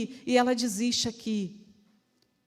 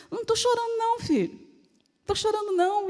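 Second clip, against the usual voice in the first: put-on higher-pitched brush-off to a child; mock-innocent mum reassuring her son; mother's denial; 7 semitones higher, faster than usual. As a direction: insist lightly that nothing is wrong. Mother denies crying to son.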